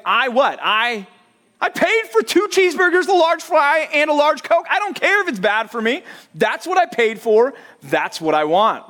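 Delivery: high, loud hammed-up complaint; a customer listing a missed order, in high mock outrage; mock indignant rant. Angry customer rant about order.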